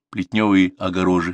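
ordinary delivery, nothing marked

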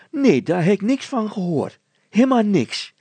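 put-on high, loud, tumbling girl's voice; a breathless girl blurting out what she saw; flustered girl. Petronella denies.

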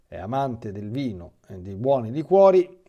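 normal talking voice

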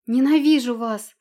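Ненавижу вас shouted loud and high; Ненавижу full-voiced yell. A narrator's reading. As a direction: scream Ненавижу at them. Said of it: "закричала она".

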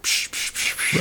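soft unvoiced psh psh sounds; splash sound effect. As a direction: puff out quiet splashing noises. Imitating splashing stormtroopers.

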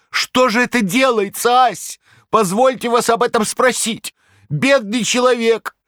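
very high, loud trembling lament; quavering plea. A narrator's reading. Korovyev appealing to the crowd, high trembling plea. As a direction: wail for pity, slowing on the last words. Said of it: "подпустил дрожи в свой голос".

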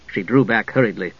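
ordinary delivery, nothing marked

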